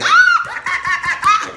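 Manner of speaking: very high, loud shriek; mock panicked scream. Retelling how scared the call made them, mock scream. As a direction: let out a piercing comic scream. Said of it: "I was already feeling like".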